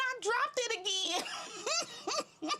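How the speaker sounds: slow, giggling admission; sheepish laugh. Retold or imitated. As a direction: confess through laughter.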